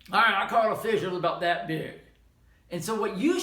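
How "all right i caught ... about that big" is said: boastful fisherman. Acted. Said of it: "somebody might say".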